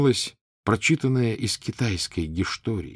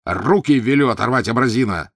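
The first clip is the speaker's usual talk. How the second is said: loud, higher furious threat; barking at the barber; 4 semitones higher, 6 dB louder than usual. A narrator's reading. Prince to the hairdresser who burned him, furious bark. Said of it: "рявкнул он на куафёра".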